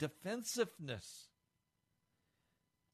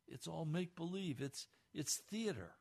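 ordinary delivery

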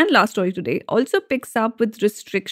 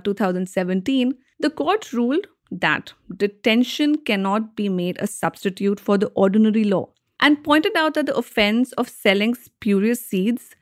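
usual speaking voice

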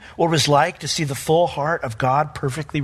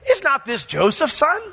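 high, doubtful scoff; skeptical townsfolk; 10 semitones higher than usual. Retold or imitated. Townspeople doubting Jesus, high mocking question. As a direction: ask it like a nosy neighbour. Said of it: "some group of people said".

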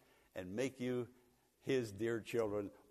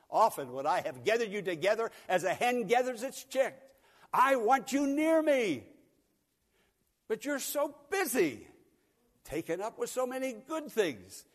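higher, louder, yearning call; voicing Jesus; 9 semitones higher, 8 dB louder than usual. Jesus calls Jerusalem to come near, higher and pleading. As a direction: plead like a shepherd calling his flock. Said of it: "Jesus said".

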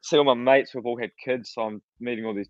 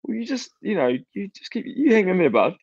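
high, halting, gentle reassurance; retold worried encouragement; 9 semitones higher than usual. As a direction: console the man you meant to scold. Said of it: "I was a bit worried about this guy".

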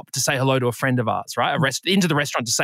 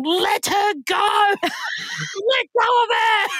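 very high, drawn-out scream; hammed-up shriek, stretched slow; mock scream; much slower than usual. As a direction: imitate a panicked bystander screaming. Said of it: "started screaming".

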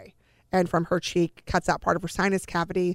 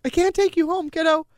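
pitched up high and louder, near tears; voice breaking; 10 semitones higher, 5 dB louder than usual. Retold or imitated. Mother telling her dying kid she can't go home, voice breaking. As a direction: let it crack upward as you plead. Telling daughter surgery news.

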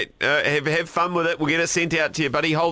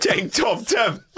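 amused echo; 9 semitones higher, much slower than usual. Real, not acted. Echoing the silly nickname.

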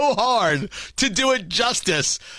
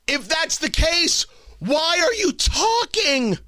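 incredulous; 7 semitones higher, slower than usual. Real, not acted. Incredulous rising question.

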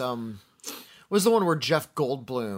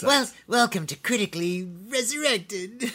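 high, put-on ghoul host voice; Crypt Keeper; 7 semitones higher than usual. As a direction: greet listeners like a cackling horror host. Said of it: "I can't do my Crypt Keeper voice".

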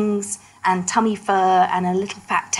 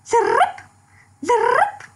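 high rolled-r chirp lirip; happy chirp; slower than usual. Shown as a demonstration. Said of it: "And it goes".

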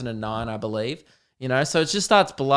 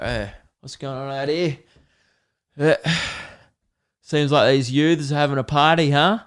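imitating his dad. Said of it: "He used to be like".

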